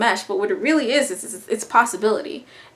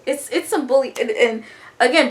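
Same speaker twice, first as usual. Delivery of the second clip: ordinary talk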